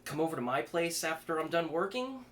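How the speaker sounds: put-on a panicked kid trying to talk his way out; flustered young man.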